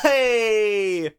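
high, drawn-out exuberant greeting; big cartoon hello, sliding down; Genie. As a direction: call it out long and showy. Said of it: "Genie appears".

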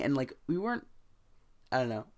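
ordinary delivery

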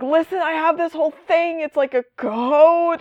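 high, pleading mock Batman voice. Batman explaining his code to Jason, high mock whine. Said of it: "and Batman's like".

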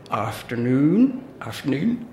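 lightly hammed country farmer accent; amateur rustic farmer accent. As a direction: greet in a shaky attempt at a farmer's accent, rising at the end. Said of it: "I'm really sorry about the accent".

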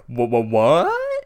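slow, stammering mock shock; loud, drawn-out stammer of disbelief. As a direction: stutter out a stunned what. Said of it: "she literally drops her books".

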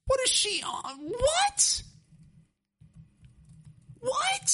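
can't believe what she just heard; reacting to mom calling cereal nasty, shocked; shocked disbelief. Real, not acted. Shocked at mom's cereal verdict.

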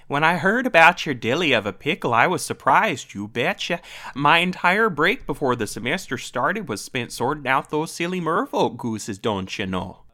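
chirpy Minnesota dragon lady, "you betcha"; Minnesota accent. Acted. Podcast host reads the dragon headmistress in Minnesota accent. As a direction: play her as a cheerful Minnesotan. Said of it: "read this dialogue".

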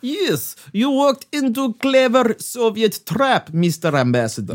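hammed-up Russian villain gloating; spring the trap in a cartoon Russian accent; mock Russian accent.

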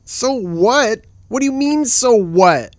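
loud, incredulous outrage; indignant echo. Acted. Tent-mate answers a dismissive companion, loudly indignant. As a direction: fling the words back in disbelief.

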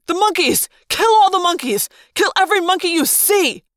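high, loud, frantic command; frenzied yelled order. A narrator's reading. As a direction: yell the order to attack. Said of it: "the human controller yelled".

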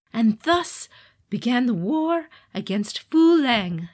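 slow, loud epic narration; epic fantasy narrator. A narrator's reading. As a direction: announce the war with gravitas.

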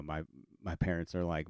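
ordinary talk

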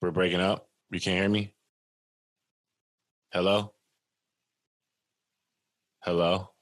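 choppy, robotic voice breaking up; words cutting in and out like a bad connection; glitchy robotic call. Real, not acted. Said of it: "You came through like a robot".

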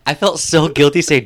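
ordinary delivery

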